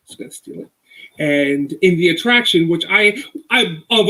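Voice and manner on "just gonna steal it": whisper. Pretend. Sly aside about stealing idea.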